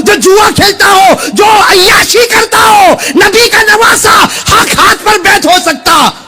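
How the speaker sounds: high, slow shouted indignation; fiery preaching. Acted. Preacher voicing Husain's refusal of allegiance to Yazid, fiery preaching. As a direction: shout each charge, drawing out the words.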